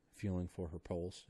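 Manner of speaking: usual speaking voice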